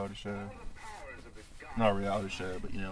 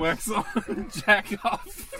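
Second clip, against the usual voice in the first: laughing high while talking; 8 semitones higher, 4 dB louder, much slower than usual. Real, not acted. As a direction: blurt it out through laughter. Laughing through a movie joke.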